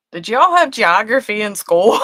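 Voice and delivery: laughing disbelief, retold; amused retold question. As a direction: ask it loudly, about to crack up. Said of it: "It's like".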